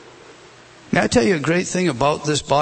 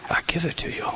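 whispered; 7 dB quieter than usual. Acted. Tender close of Paul's quote.

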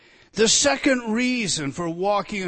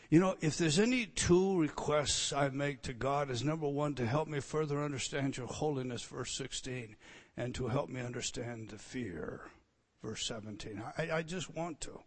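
very soft, lower, sincere; hushed prayerful confession; 4 semitones lower, 10 dB quieter than usual. Real, not acted. Preacher sharing his prayers with the church, hushed. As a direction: nearly whisper your two requests to God.

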